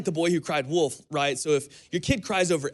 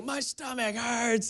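a hint of a whiny kid, drawn out and higher; mild whining; 7 semitones higher, 3 dB quieter, slower than usual. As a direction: mimic a hurt boy whining, slowly. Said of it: "he's whining".

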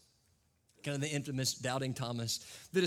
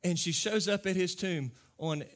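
normal talking voice

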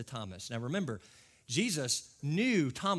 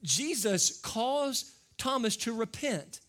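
ordinary delivery

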